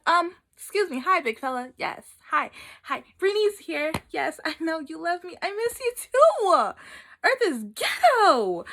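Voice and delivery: high, loud, chummy greeting to God; sassy spirit. Acted. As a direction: hail God like an old pal, drawing out the complaints. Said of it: "my spirit left me, went up and talked to God".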